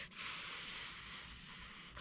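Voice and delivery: long, soft hiss; drawn-out pretend snake sound. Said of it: "snakes all hissing".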